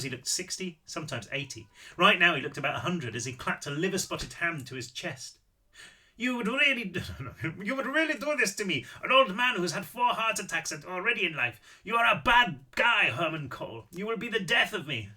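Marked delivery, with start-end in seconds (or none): 6.2-15.1 put-on high, indignant old man with an Austrian accent; heavy Austrian accent. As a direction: melodramatic Austrian old-timer, pitched up. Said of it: "Apparently I am doing the accent".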